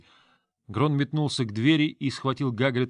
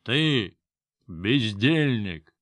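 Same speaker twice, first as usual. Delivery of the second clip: put-on drunken roar; much slower than usual. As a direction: roar the insult, dragging it out. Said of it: "а потом взревел".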